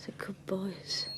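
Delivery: slow tender whisper. Acted. Wife about her sons, slow whisper. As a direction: breathe it out with relief. Hushed late-night talk.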